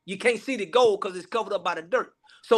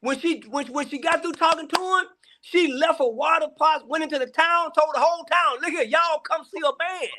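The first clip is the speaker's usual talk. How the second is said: excited storytelling; 7 semitones higher than usual. Real, not acted.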